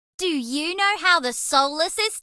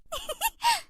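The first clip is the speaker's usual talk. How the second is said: giggle; 6 dB quieter than usual. Acted. Giggling alien mouse character.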